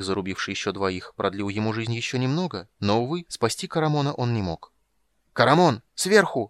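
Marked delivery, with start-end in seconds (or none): 5.3-6.5 high, loud urgent cry; alarmed, rising yell; shouted warning. A narrator's reading. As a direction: shout to warn of danger from above. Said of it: "крикнул рыцарь".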